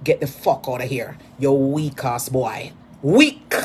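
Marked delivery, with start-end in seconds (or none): 3.0-3.5 much higher, louder single-word jeer; jeering yell. Real, not acted. [name] mocking a weak boy, yelling. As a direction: fling the word at him.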